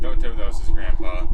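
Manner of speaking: very loud disbelieving cry; shocked outburst. Real, not acted. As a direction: blurt it out loud. Shocked reaction.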